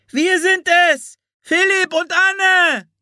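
put-on very high, loud girl's shout; calling out. A narrator's reading. Anne calls to the rescuers on their mounts, high drawn-out shout. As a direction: holler names across a distance. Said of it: "rief Anne".

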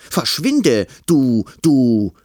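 loud, high, flustered yell; shouting. Acted. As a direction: shout at the intruder, stammering. Boy threatens monster with racket.

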